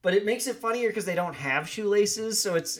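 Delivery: usual speaking voice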